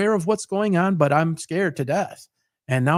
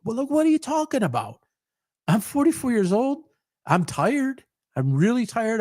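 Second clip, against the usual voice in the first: disbelieving protest; 6 semitones higher than usual. Retold or imitated. Arguing with doctors in trauma.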